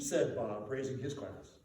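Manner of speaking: ordinary delivery, nothing marked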